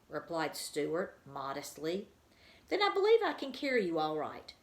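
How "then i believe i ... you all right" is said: high, quick, bright-spirited bird; light bird voice. A narrator's reading. Margalo telling Stuart she can carry him, high and quick. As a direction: chirp it out fast with confidence.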